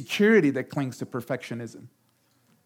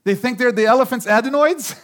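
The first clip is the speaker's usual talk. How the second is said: high, loud indignant scoff; haughty retort; 6 semitones higher, 5 dB louder than usual. Acted. Proud person dismissing a critic, high and haughty. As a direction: sniff at their nerve. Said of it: "she doesn't say".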